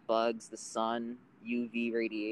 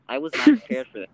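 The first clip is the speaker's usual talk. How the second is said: startled exclaim; 2 semitones higher, 11 dB louder, faster than usual. Retold or imitated. Reacting to a jump scare.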